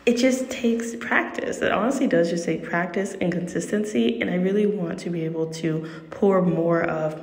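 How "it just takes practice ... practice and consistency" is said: ordinary talk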